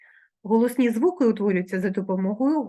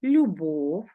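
slow example word; 4 semitones lower than usual. Shown as a demonstration. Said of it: "наприклад".

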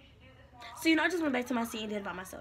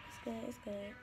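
trailing off softer; fading; 7 dB quieter than usual. Real, not acted. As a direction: let it fade to almost nothing. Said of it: "But, you know, it's good".

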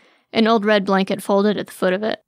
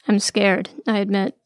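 usual speaking voice

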